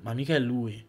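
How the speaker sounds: usual speaking voice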